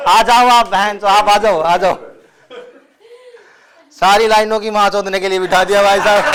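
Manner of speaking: loud mock exasperated yelling; ranting comic. Real, not acted. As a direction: bellow at the crowd, comically fed up. Comic yelling at crowd row.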